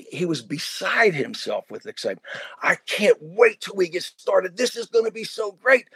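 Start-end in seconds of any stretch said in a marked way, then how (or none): 2.6-5.9 eager, slow, lifted delivery; excited kid. Retold or imitated. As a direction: play the thrilled student. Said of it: "he was so excited".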